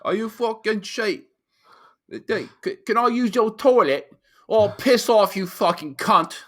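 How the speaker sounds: high, loud cursing British lad; strong British accent. Retold or imitated. Imitating British lads who curse constantly, strong British accent. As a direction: put on a thick, foul-mouthed British accent. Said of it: "like, the really strong".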